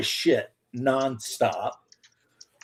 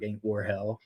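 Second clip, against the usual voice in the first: quiet deadpan remark; 5 semitones lower, 10 dB quieter than usual. Retold or imitated.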